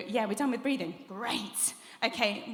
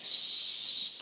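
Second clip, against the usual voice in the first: held shh. Shown as a demonstration. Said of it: "back really coming into it".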